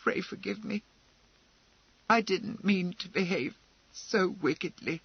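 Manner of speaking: trembling. A narrator's reading.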